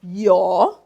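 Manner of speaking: yo held long like a double O. Shown as a demonstration. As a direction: draw out the o in yo. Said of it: "It's like a double O".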